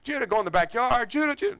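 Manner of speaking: calling out. Retold or imitated. Father yelling for his son in the backyard, high. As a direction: yell across the backyard. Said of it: "now we're screaming".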